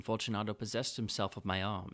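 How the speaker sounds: ordinary delivery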